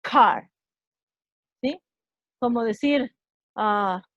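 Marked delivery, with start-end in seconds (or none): 0.0-0.5 "Car" with a strong, aspirated C; "Car" as an English model word, C released with a burst; explosive English C. Shown as a demonstration. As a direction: punch the C at the start of "Car". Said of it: "acentuaría el sonido de esta letra".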